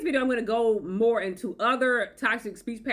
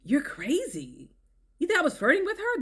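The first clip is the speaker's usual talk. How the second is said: mock incredulous; 7 semitones higher than usual. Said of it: "gaslighting and denial".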